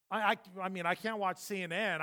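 usual speaking voice

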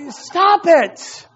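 retold exasperated dad's command; irritated parent. Irritated father retelling his order.

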